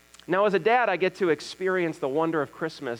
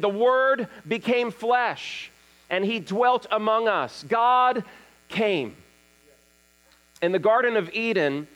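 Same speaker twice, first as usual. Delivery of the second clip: higher-pitched, declaimed phrases with weighty pauses; emphatic preaching; 5 semitones higher than usual. Real, not acted. Pastor opening the sermon on God came, emphatic preaching. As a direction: proclaim each phrase to the whole room.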